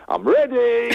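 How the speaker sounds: high, bright mock yell from behind the camera; dad shouting. Dad behind the video camera calls out, mock yell. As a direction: sing out like an eager dad filming. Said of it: "my dad shouting".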